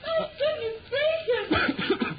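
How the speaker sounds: high, slow gasp of alarm; shocked exclamation. Acted. Mother alarmed as Henry chokes on water, high drawn-out exclamation. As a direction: draw the words out in high alarm.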